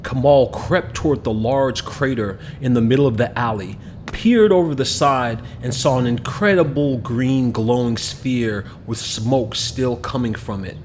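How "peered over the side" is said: usual speaking voice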